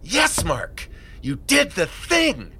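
high, elated self-congratulation; giddy, drawn-out cheer; too excited. Acted. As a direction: hype yourself up with big swooping emphasis. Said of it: "don't get too excited".

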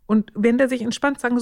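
normal talking voice

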